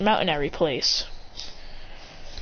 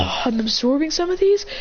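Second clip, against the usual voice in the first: high, rising, puzzled question; mock surprise; 8 semitones higher than usual. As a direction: voice a fighter startled by their own power. Character amazed at own new power.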